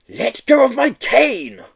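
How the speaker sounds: put-on high, loud childish shriek; petulant shriek. Headmaster to the Yeti, high petulant shriek. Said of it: "shrieked Mister Mincebrains".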